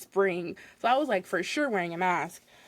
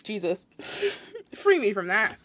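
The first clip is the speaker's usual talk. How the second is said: ordinary talk, nothing marked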